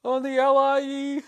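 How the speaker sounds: high-pitched, loud squeal of a coward cracking; comically cracking under questioning, voice shooting way up; panicked confession. Acted. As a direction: blurt it out in a mock-terrified high voice. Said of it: "slam the door and be like".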